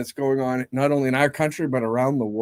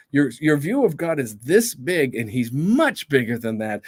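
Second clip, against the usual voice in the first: ordinary talk, nothing marked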